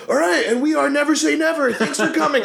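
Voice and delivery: high, hammed-up stage announcer; mock frontman. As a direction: announce the band to the crowd. Frontman opening the show.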